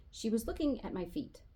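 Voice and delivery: usual speaking voice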